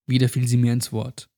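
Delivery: ordinary delivery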